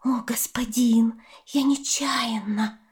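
breathless whisper, pleading; whispered excuse. Acted. Snake whispers excuses to the dragon. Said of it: "оправдывалась змея".

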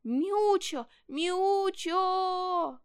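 high, loud, drawn-out call. A narrator's reading. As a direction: call through the woods for a lost kitten. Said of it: "продолжал звать своего котёнка". (громкий протяжный зов)

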